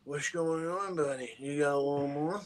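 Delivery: junkie voice. Pretend. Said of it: "that junkie voice that I do".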